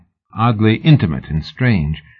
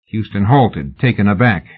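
ordinary delivery, nothing marked

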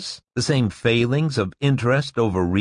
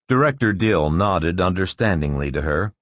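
ordinary delivery

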